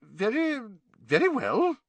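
high, hesitant startled reply. A narrator's reading. Startled nurse agreeing to the headmaster's request, flustered. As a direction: stumble into agreeing, taken aback. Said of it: "looking startled".

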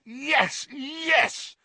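high, strained cheer; excited yell. A narrator's reading. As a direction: celebrate wildly in a high voice. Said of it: "Ron yelled".

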